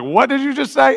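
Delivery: high, startled mock reply; shocked reply. Stranger reacting in shock to a bold question. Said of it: "they look at you like".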